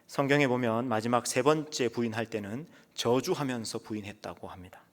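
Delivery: usual speaking voice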